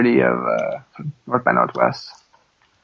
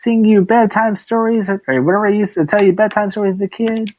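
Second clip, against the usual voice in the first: higher-pitched acted mother voice; Sloth's mother; 7 semitones higher than usual. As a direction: play the mother coaxing with old bedtime memories. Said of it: "and she's like".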